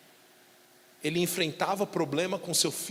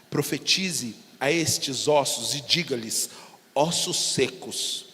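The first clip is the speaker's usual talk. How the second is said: solemn scripture reading; 5 semitones lower, slower than usual. Acted. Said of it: "reading the verse".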